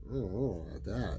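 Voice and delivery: ordinary delivery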